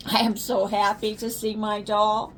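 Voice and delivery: usual speaking voice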